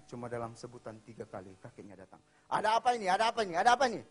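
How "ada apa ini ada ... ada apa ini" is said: high, loud, urgent demand; alarmed grandfather. Retold or imitated. Grandfather rushing to the screaming boy, alarmed shout. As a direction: burst in demanding an explanation.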